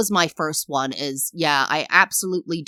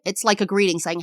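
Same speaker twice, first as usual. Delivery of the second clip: ordinary talk, nothing marked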